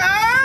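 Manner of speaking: high mock child's shriek; kid's scared wail. Streamer mimics the kid shrieking at his mom. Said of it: "And he's like".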